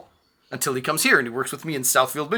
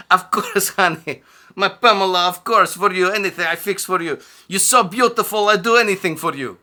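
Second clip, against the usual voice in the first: put-on higher, louder Eastern European accent, fawning; hammy accented sweet talk to a pretty girl; 6 semitones higher, 5 dB louder than usual. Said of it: "Roman says".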